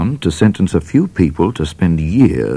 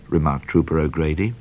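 ordinary delivery

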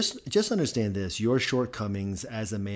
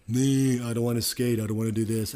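lightly voiced complaining kid, louder at the start; mock reluctant kid; 2 semitones higher, 6 dB louder than usual. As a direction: whine it like a kid who won't skate. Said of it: "the hockey kid you're trying to coach that's like".

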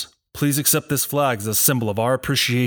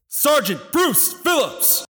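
stiff barking toy soldier; slower than usual. Acted. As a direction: shout the full name across the field. Roll call, no answer.

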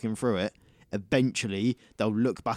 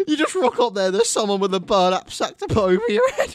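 laughing joke; 10 semitones higher, 8 dB louder than usual. Real, not acted. Joking riff while laughing.

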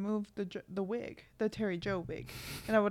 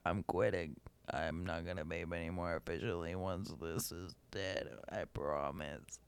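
very low gravelly croak; wrecked smoker voice; 6 dB quieter than usual. Pretend. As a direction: sing like a ruined smoker. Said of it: "how y'all are gonna sound".

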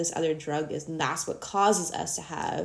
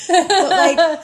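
laughing; 11 semitones higher, 12 dB louder than usual. Real, not acted. Both cracking up mid-point.

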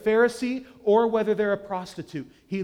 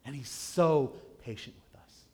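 ordinary talk

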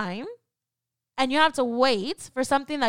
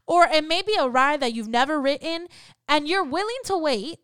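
ordinary talk, nothing marked

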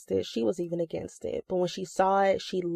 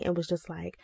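ordinary delivery

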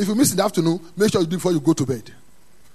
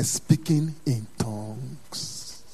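very slow, low and soft; drawn-out emphasis; 5 semitones lower, 4 dB quieter, much slower than usual. Real, not acted. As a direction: stretch each word out in a hushed drop. Drawn-out emphasis on key phrase.

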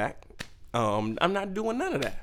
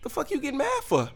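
mock outraged yell. Comedian yelling at a mom who got mad at him, high and indignant. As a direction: throw it back at her, high.

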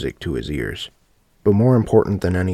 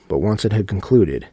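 usual speaking voice

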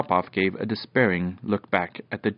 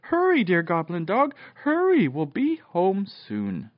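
high, urgent cheering on; excited shouting; 9 semitones higher, 3 dB louder than usual. A narrator's reading. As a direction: spur the dog on excitedly. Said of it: "shouted Fenella".

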